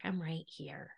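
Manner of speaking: hushed, near-whisper, moved to tears; very softly reassure, choked up; tearing up. Real, not acted. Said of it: "I'm tearing up".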